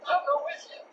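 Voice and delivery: high timid dog voice, surprised; timid sidekick dog. Acted. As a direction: squeak it out in disbelief. Drover's high voice.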